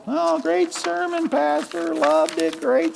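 high, slow, airy fake praise; a churchgoer gushing insincerely, pitched high and slow; mock churchgoer. A churchgoer thanking the pastor at the door, breezy insincere praise. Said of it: "it gets filed".

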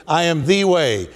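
stressed "the", pronounced "thee"; emphatic THE. Acted. Preacher repeats Jesus's answer to Thomas, emphatic "the" said as "thee". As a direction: make "the" a weighty "thee". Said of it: "he uses the emphatic".

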